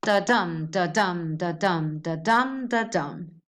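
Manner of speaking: each "da-dum" weak then strong; iambic rhythm demo. Shown as a demonstration. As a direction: chant an even "da-dum", stressing every "dum". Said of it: "And the rhythm would go".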